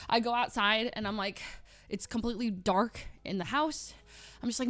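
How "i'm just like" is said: normal talking voice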